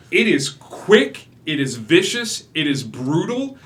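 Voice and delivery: loud, slow, weighted "quick", "vicious", "brutal"; building dread in a slow deliberate list; emphatic triple. A narrator's reading. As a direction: land each word like a blow.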